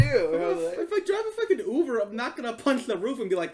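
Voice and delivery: excited. Real, not acted. Heated protest.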